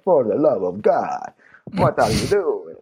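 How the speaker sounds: put-on slow, exasperated Italian accent; hammed-up Italian accent, hands to God. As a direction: plead like an Italian rider, slowly. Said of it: "That's my Italian accent".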